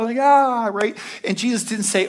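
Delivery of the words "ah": a sudden loud panicked "Ah"; panicked cry. Retold or imitated. Mary and Martha panicking over Lazarus, a loud mock cry. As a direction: sisters crying out in alarm. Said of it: "they're kinda, like, freaking out".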